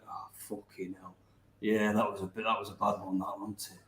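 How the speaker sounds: soft, low mock mumble of regret; lightly voiced sheepish director, mumbled under the breath; muttered embarrassed quote. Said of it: "Like that".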